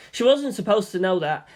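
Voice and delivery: ordinary delivery, nothing marked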